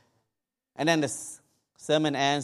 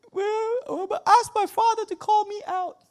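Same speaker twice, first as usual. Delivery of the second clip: a weeping son begging God for a sign; crying prayer; 4 dB louder than usual. Retold or imitated. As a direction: pray through tears, voice cracking high. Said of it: "Crying and says".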